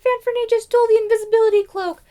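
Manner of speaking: high, fast tattling whine; whiny tattling princess. Retold or imitated. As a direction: tattle it breathlessly.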